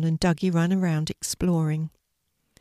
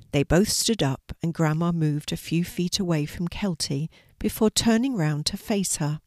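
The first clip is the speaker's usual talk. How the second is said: plain reading voice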